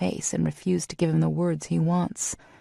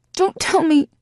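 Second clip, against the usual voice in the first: high, loud protest; cut-off shout; 11 semitones higher, 6 dB louder than usual. A narrator's reading. Heroine protesting loudly before she is cut off.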